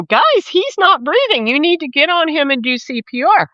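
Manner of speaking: acted urgent plea. Port chief to agents over dying man, imitated urgency.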